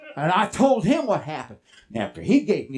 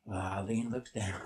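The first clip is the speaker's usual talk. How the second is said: low looking down; 12 semitones lower, 12 dB quieter than usual. Acted. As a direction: drop low and soft. Horse looking down, acted by pitch.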